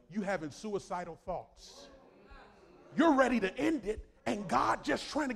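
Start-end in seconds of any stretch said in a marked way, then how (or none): none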